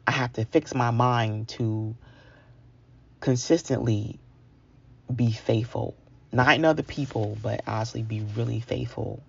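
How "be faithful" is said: ordinary talk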